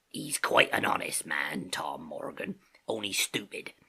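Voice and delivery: low, slow, hushed aside; Silver's confidential whisper. A narrator's reading. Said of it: "in a confidential whisper".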